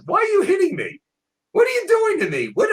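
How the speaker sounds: high-pitched bewildered donkey asking why; talking donkey. Acted. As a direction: squeak it out as the wronged donkey. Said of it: "and says".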